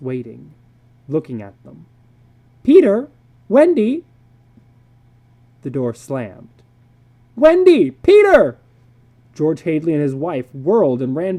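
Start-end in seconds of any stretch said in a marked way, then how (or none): 2.6-4.0 high, loud urgent call. Acted. Parents calling children. 7.4-8.6 high, very loud panicked yell; panicked shout. Acted. Parents calling children.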